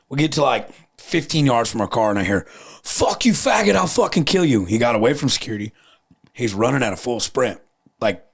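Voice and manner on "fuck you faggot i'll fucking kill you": retold yell. Attacker yelling from distance.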